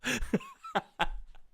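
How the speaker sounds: high-pitched helpless giggles; giggling fit. Real, not acted. Fan overcome after the goal, giggling fit. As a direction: dissolve into giggles. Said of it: "I've got the giggles".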